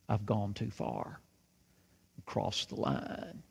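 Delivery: low, slow, soft inner thought; hushed regret. Retold or imitated. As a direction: say it quietly to yourself, drawn out. Reverend's worried private thought.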